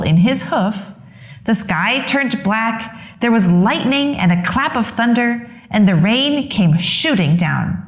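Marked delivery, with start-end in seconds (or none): none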